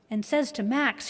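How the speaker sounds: normal talking voice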